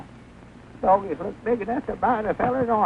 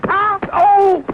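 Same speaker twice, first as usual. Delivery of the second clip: high, loud startled cry; shocked gasp; 9 semitones higher, 7 dB louder than usual. Acted. Man learns the bug was a tarantula, alarmed cry. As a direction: jolt of alarm at the news.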